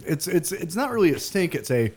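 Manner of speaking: usual speaking voice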